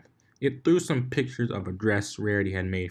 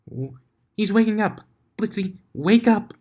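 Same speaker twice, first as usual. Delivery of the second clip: much higher, bursting with excitement; energetic cry; 12 semitones higher, 6 dB louder than usual. Acted. Pinkie spotting Blitz stirring, squealing with excitement. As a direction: squeal it out, thrilled he's waking. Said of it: "an energetic voice cried out".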